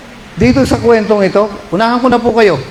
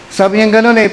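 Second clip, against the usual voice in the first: ordinary delivery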